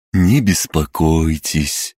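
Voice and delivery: slow, soothing doctor voice; lulling voice. Acted. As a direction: lull him to calm. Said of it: "убаюкивающим голосом произнёс".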